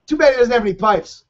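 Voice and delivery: high, playful ironic tease; sarcastic. Acted. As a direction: joke that the singer can't sing. Sarcastic joke about singer.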